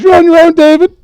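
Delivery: high, loud panicked yell; panicked shout. Acted. Rintal yells at David as she flees, high and loud. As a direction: shriek it while bolting. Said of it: "she goes".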